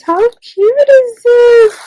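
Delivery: high, loud, slow gushing squeal; overwhelmed with delight at a gift. Real, not acted. Crafter opening a gift box, squealing with delight.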